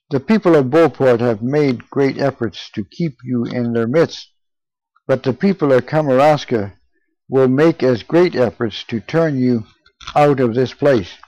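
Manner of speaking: usual speaking voice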